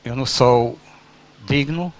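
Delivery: usual speaking voice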